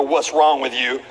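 low, slow, weary reproach; mock disappointed God. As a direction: drag it out like a let-down father. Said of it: "for God to look at you and go".

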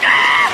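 loud burst of helpless laughter; cop dying laughing. Acted. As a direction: crack up loudly like the cop. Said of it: "The cop starts dying".

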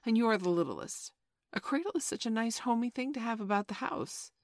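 high, mildly tart motherly insistence; almost tart. A narrator's reading. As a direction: press the point with a touch of tartness. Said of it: "she said almost tartly".

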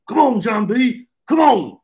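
loud, high shouted "Come on"; shouting dad. Retold or imitated. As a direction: yell it like an exasperated dad. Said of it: "John B's dad in every single waking moment".

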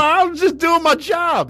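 very high, loud mock-Southern protest; slow, cartoonish Southern squawk; Southern accent. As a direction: whine it in a drawn-out Southern voice. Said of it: "you don't talk Southern".

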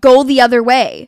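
very loud exasperated order; retold fed-up shout; annoyed. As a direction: raise your voice, patience gone. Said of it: "very obviously annoyed".

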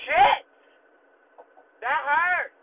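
high, loud yelp of agony; shout of pain. Retold or imitated. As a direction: cry out as if just ripped. Acted outcry at painful wax rip.

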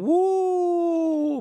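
slow build to a high whoop; excited whoop. Acted. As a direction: break off with a gleeful woo. Work rhythm ends in exclamation.

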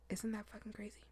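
hushed real whisper; soft unvoiced aside to the viewers. Real, not acted. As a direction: lean in and whisper it.

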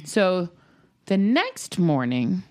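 ordinary delivery

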